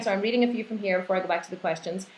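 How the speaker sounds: normal talking voice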